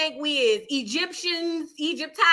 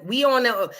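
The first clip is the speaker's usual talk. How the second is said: ordinary talk, nothing marked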